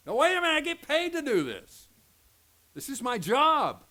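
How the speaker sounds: loud, high indignant protest; hammed-up worker defending his job; protesting repair man. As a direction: shout back like the man cutting your power. Voicing the electricity man's protest.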